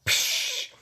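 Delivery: short hissed "psh" of a door shutting; bus door hiss. Acted. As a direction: imitate a bus's air door closing. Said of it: "the bus driver closes the door".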